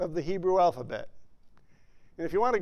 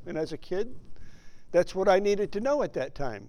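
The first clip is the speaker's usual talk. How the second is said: ordinary talk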